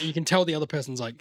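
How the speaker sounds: ordinary delivery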